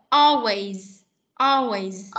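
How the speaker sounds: "Always" ending on a buzzing z, not s; voiced final s in always. Shown as a demonstration. Teacher modelling always with a final z sound. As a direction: say "Always" with a vibrating z at the end. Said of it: "Con rung ở cổ".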